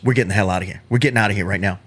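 low mock growl of an angry boyfriend; gruff boyfriend. Super Brooklyn storms out, in a low mock growl. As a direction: drop down deep and grumble it out. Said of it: "and he goes".

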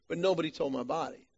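ordinary delivery

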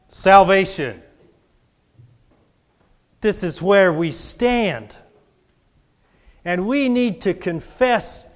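rising preacher's call to the congregation; preaching. Acted. Preacher urging the church to confess, preaching. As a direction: preach it out strong.

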